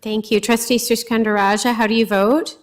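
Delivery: usual speaking voice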